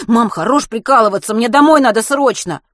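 loud, high, exasperated demand; yelling into phone. A narrator's reading. Boy demanding mum stop joking, yelling. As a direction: holler down the line, urgent. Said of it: "заорал в трубку".

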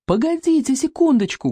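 high, urgent call to hold on. A narrator's reading. Boy calls to the fleeing crowd, calling out. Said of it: "крикнул Экинг".

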